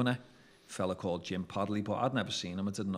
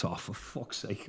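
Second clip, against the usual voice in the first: slow, softer deflated groan; retold sinking realisation; mild dismay; 5 dB quieter, slower than usual. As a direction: let it sink out slowly, under your voice. Retold dismay on learning who he is.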